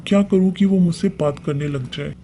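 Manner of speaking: ordinary delivery, nothing marked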